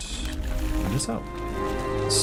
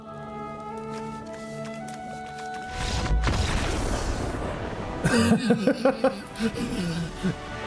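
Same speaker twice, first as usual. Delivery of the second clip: high burst of laughter. Real, not acted. Laughs at opponent's hand.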